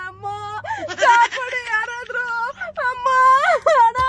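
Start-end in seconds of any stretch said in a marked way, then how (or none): 1.0-4.0 loud, slow tearful call; crying for help. Real, not acted. Frightened captive calls on anyone to save her, crying out. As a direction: call out for rescue through tears.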